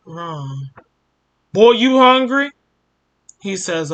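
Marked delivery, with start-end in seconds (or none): 1.5-2.5 put-on louder, higher gruff father barking at the boy. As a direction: call through the door like a harsh dad. Father barges in on son.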